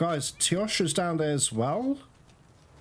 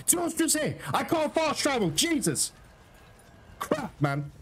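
exasperated; 8 semitones higher than usual. Real, not acted. Frustrated by game's obstruction.

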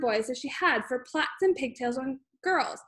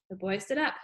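plain reading voice

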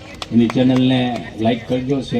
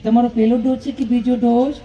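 high, sweet nurse voice, much higher; nurse's voice; 11 semitones higher than usual. Retold or imitated. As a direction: mimic the nurse asking politely. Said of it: "તો નર્સબેને એવું પૂછ્યું". (ઊંચા મીઠા અવાજે નર્સની નકલ)

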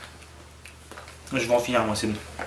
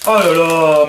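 disgusted; 6 semitones higher, slower than usual. Real, not acted. Disgusted at weird candy.

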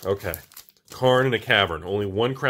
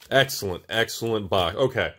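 ordinary talk

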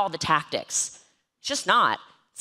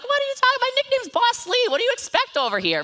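high indignant retort, much higher; mock indignant; faster than usual. Quoting herself to frustrated recruits, high and indignant. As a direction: throw it back, high and affronted. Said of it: "Then I'm like".

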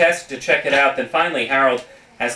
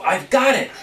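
bright idea, pitched high; higher, perky realisation; perked up; 6 semitones higher than usual. Acted. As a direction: announce a sudden brainwave. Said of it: "Kate perked up".

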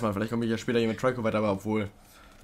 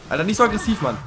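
higher and louder, telling it off; startled protest; 8 semitones higher, 6 dB louder, faster than usual. Real, not acted. Player protests as Trico thrashes about, loud and startled. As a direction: cry out at the rough beast.

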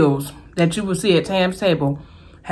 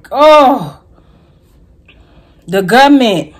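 high, loud outraged cry; exasperated exclamation; 5 semitones higher, 8 dB louder, slower than usual. Real, not acted. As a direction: cry out at the government.

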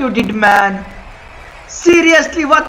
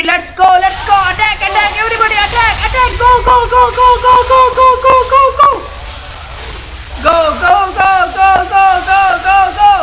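battle cry; 7 semitones higher, faster than usual. Real, not acted. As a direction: yell the charge. Leading team charge.

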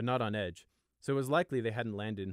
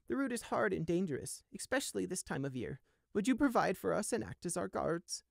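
put-on a high, wary old ewe pressing with pointed questions; old sheep voice; 12 semitones higher than usual. Old ewe challenging the guard: high, wary.

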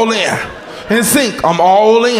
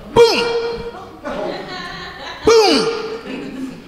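boom, boom, like a foot on a seat; punchy imitated kick sounds; mock kick thuds; 13 semitones higher, 5 dB quieter than usual. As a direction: thump it out like a kick to the chair.

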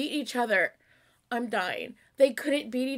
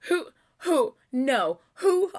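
loud, rising, crying out; excited shock; 2 semitones higher, 5 dB louder than usual. Real, not acted. Fan shouting at a surprise cameo. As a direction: call out the question in thrilled disbelief.

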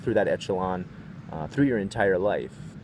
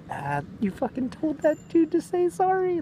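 high excited mock teen voice; high school kids. Teen delinquents praising him, high and excited. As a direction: hype it up like an impressed teenager. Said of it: "little high school guys were like".

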